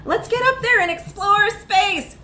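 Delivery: high, loud, slow gung-ho pitch; eager recruiter. Acted. Recruiter urges the reluctant astronaut, hammed-up eager pitch. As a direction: sell space like a cheerleader.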